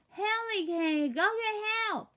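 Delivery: high, drawn-out plea with stuck lips; garbled, panicked high wail; muffled cry for help. Acted. Boy with lips frozen to the mirror, muffled cry for help.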